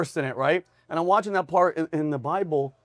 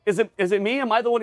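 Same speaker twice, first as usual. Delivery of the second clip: lightly anxious, higher-pitched questioning; mock worried pleading, pitched up; anxious disciple; 6 semitones higher than usual. Disciple asks Jesus about the betrayal, mock anxious.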